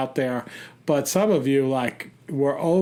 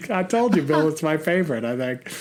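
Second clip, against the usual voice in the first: ordinary delivery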